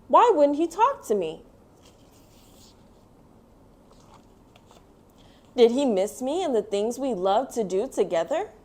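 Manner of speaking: put-on gentle storybook narration read to kids; reading to children. A narrator's reading. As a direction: tell it like a bedtime picture book. Reads picture book aloud.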